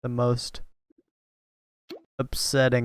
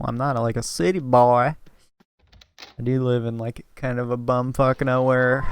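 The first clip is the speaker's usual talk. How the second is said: ordinary delivery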